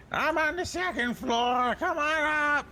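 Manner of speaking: high voice calling from upstairs; calling lion voice. Acted. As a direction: call them up as the lion.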